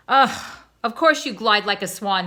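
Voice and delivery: mock-irritated wife brushing off her husband; scoffing wife. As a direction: deflate his grand talk with a sneer.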